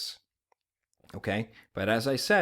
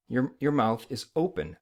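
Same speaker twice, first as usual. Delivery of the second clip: usual speaking voice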